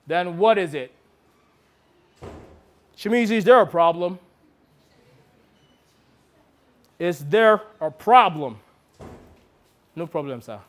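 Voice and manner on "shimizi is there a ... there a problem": pressing challenge. Retold or imitated. Director pressing actor.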